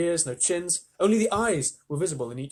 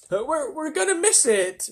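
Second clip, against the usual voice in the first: shouting; 12 semitones higher, 4 dB louder than usual. A narrator's reading. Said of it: "shouted the ladybird".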